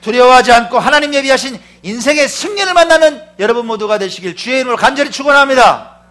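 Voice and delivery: loud, high benediction; fervent blessing. Acted. Pastor blessing the congregation, loud and high. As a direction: pronounce the blessing with fervor.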